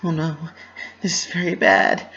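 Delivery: high, bright-voiced filly in dismay; worried Pinky. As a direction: fret it out in the cartoon pony voice. Pinky's voice in the story.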